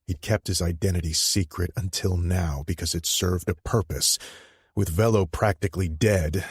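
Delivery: plain reading voice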